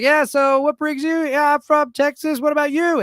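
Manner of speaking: high, carrying voice over loud music; projected voice. Retold or imitated. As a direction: project it across a noisy venue. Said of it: "you gotta project your voice".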